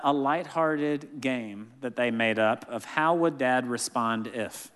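usual speaking voice

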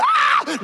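very high loud relieved shriek; joyful squeal. Retold or imitated. Pastor relieved at airport security, squealing with joy. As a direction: let out a sudden delighted squeal. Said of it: "I said".

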